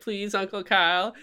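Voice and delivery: put-on high, far-off little-kid plea; pleading kids. Kids pleading with Uncle Kyle, high childlike beg. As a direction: beg like the South Park kids. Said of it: "And all the kids like".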